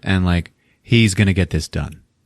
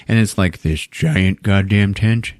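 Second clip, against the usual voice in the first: usual speaking voice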